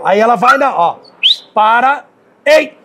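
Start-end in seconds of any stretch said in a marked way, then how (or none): 1.5-2.8 sharp command. Real, not acted. Trainer halting the dog on the rope, sharp shouted command. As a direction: stop the dog dead with one sharp call. Stopping the dog's bite.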